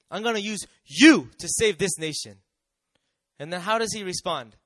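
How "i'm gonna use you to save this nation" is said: God's promise, louder. Acted. Repeat, much louder.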